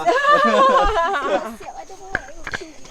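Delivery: bursting into high laughter. Real, not acted. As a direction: laugh out loud, high and bright. Laughing at the vlog.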